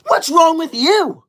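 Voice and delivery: high loud, slow indignant echo; indignant repeat. Real, not acted. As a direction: throw the question back. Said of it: "[name] comments".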